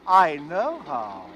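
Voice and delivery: slow, deliberate menacing claim; sinister. Acted. Stranger hinting he knows how to kill, slow menace. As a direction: let each word hang with menace.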